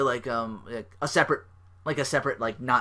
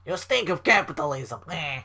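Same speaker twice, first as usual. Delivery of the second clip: bad Russian accent; 4 semitones higher than usual. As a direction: spit it out as a cartoon Russian. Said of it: "really bad stereotypical Russian accent".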